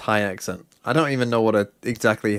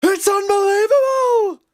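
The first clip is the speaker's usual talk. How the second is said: very high, loud, drawn-out hype scream; Seth super hyped; 6 dB louder, slower than usual. Shown as a demonstration. Caster imitating a colleague going super hyped, shrieked long and high. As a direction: scream it like an overexcited esports caster. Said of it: "how does Seth sound when he goes, like, super hyped?".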